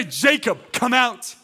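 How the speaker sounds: high, loud summons; forceful shout to raise the dead; commanding voice. Acted. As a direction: call a name out with full authority. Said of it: "commanding voice of the authority".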